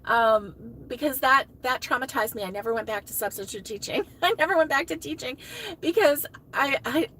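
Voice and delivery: usual speaking voice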